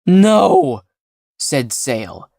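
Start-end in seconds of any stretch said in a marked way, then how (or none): 0.1-0.8 terrified. A narrator's reading. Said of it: "face melting with terror".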